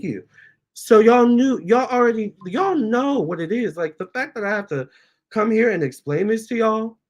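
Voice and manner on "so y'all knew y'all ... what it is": exasperated outburst. Real, not acted. Upset, defending herself to viewers.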